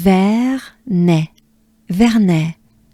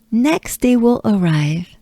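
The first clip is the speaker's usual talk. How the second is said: ordinary talk, nothing marked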